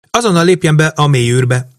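high-pitched, insistent command; urgent order. A narrator's reading. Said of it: "utasította Emese a főpilótát".

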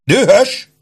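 high-pitched, outraged cry; incredulous shout. A narrator's reading. Old captain told he is angry, shouting back. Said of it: "Kiáltotta az öreg".

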